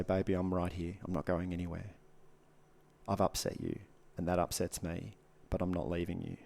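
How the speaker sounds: low, soft, unhurried comfort; tender reassurance. Shown as a demonstration. Man outside her bedroom door, tender reassurance. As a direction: soothe gently, keeping the voice down.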